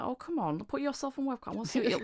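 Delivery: high wheedling mock plea; coaxing. Friends talk her onto webcam, coaxing.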